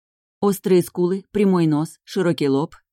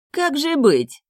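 high-pitched false concern; venomous smile; 10 semitones higher, 3 dB louder, much slower than usual. A narrator's reading. Brunet toys with the groom, venomously sweet. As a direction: feign helplessness through a poisonous smile. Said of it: "Гаин осветился ядовитой улыбкой".

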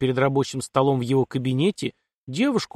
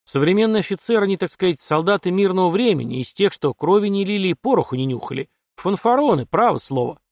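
lightly voiced colonel, higher pitch; disdainful colonel; 6 semitones higher, 3 dB louder than usual. Colonel to his daughter about modern officers, mildly disdainful. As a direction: grumble with a touch of scorn.

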